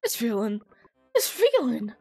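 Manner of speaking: hammed-up awe at a returning gift; pitcher, elated. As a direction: build to a high, loud revelation. Pitcher regains his feeling.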